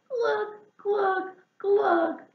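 high, gurgling glugs; gurgled glug. Acted. Hedgehog pulled out of the pond, high gurgle. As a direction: gurgle it like swallowing water. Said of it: "gurgled Hedgehog".

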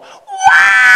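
sudden very high, loud wail; puppet wail. Pretend. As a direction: scream as the flying puppet head. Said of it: "make the head go".

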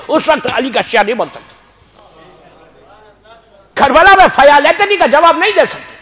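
normal talking voice

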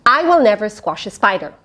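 ordinary delivery, nothing marked

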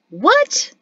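high, loud cry of disbelief; startled, incredulous outburst; shocked exclamation. A narrator's reading. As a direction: exclaim it, shooting up in pitch. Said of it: "Peyto exclaimed".

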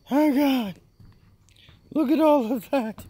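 high put-on falsetto exclamation; mock amazement. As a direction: marvel in a silly high voice. Mock awe at the work done.

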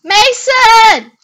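high, loud indignant call; yelling out a name. Real, not acted. Player calls out Mason mid-game, yelling. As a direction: holler the name, drawn out.

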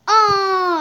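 loud, high drawn-out "oh"; dismayed outcry. Real, not acted. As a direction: cry out at losing. Player dies in game.

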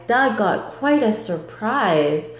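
ordinary delivery, nothing marked

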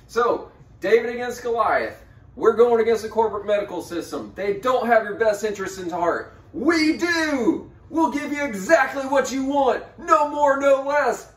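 loud, pushy sales pitch; used car salesman. Acted. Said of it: "kinda sounded like a used car salesman".

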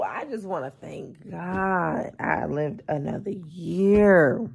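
ordinary talk

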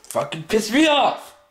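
angry outburst. Real, not acted. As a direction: pitch jumps up, blurting it out. Outburst after the whispers.

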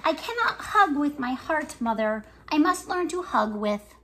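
lively picture-book reading with voices for each character; reading to children. Acted. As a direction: read it like story time for little kids. Reads picture book aloud.

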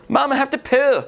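put-on high childish plea; whiny kid voice. Boy tells his mom at McDonald's he needs to poo, high and whiny. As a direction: whine like a little boy.

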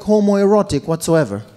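ordinary delivery

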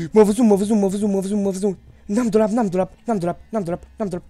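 loud, high, rapid-fire repeats; panicked. Real, not acted. Player spotted with no closet to hide in, panicked yelling. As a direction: yell it over and over in a panic. Spotted, no hiding place in game.

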